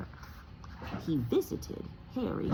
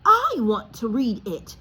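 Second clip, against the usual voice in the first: put-on loud, higher angry boy; furious; 6 semitones higher, 9 dB louder than usual. Harry to Uncle Vernon, furious and loud. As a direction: insist hotly. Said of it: "said Harry furiously".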